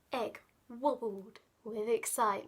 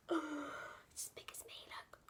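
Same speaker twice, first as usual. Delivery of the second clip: gasping whisper; 14 dB quieter than usual. Real, not acted. Gasped aside.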